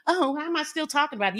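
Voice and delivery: high-pitched mock puzzlement; mocking realization. Woman mimicking the man as he stops ranting, mocking. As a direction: imitate him suddenly catching himself, pitched high.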